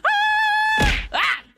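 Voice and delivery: high happy shriek; excited squeal. Real, not acted. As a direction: squeal with joy. Excited squeal after announcement.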